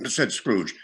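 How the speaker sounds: ordinary delivery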